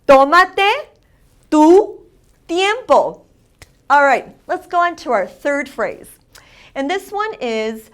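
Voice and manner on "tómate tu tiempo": loud, high TÓmate, rising, then "tu", then "tiempo"; emphatic teacher's repeat of "Tómate tu tiempo", first syllable stressed; accent on the O. Shown as a demonstration. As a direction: punch the O of "Tómate", pause between words. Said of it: "watch out for that accent over the O".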